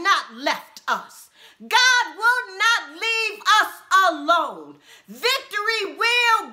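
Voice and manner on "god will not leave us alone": ordinary talk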